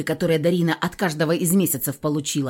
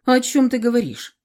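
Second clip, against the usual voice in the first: higher, louder surprised question; 7 semitones higher, 4 dB louder than usual. A narrator's reading. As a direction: caught off guard. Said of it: "удивился Декабрь".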